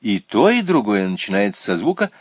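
ordinary delivery, nothing marked

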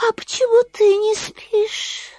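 a sleepy bat speaking sweetly; squeaky bat voice. Acted. Bat asks Masha.

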